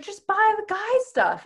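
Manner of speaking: mock someone offering an easy fix; imitated companion's advice. Companion advising her while she seethes, imitated pushy advice.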